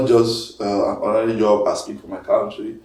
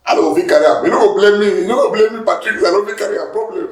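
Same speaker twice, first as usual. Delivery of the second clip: mock protest; 8 semitones higher, 5 dB louder than usual. Mock protest to critic Patrick.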